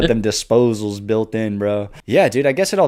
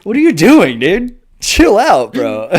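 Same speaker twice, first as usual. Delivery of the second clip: high, loud disbelieving shout; exasperated yell; 8 semitones higher, 7 dB louder, slower than usual. Retold or imitated. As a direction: yell at the guy on TV to stop. Yelling at croc-jumper on TV.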